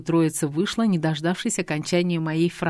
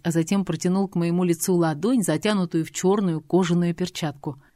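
usual speaking voice

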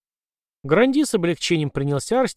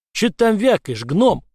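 gruff demand; 6 semitones higher, 5 dB louder than usual. Acted. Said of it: "потребовал он объяснений".

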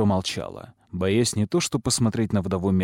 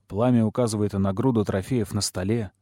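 ordinary delivery, nothing marked

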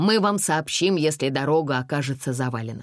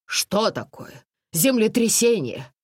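rising alarm, higher pitch; startled and scared, asking what is happening; frightened question; 8 semitones higher, 3 dB louder, slower than usual. A narrator's reading. Aunt Toma, frightened in the dark tunnel, asks. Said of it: "испуганно спросила тётя Тома".

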